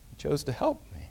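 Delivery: ordinary talk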